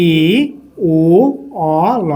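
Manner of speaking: "ই উ অ ল" in separate, held sounds. Shown as a demonstration. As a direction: pause after each sound of "ই উ অ ল". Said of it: "তাহলে কি হয়ে যাবে?".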